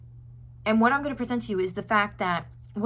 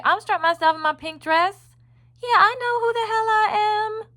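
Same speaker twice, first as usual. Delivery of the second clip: put-on high, loud sassy little girl voice; 12 semitones higher, 7 dB louder than usual. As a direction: play a proud little girl who knows who she is. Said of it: "She's a sassy little lady".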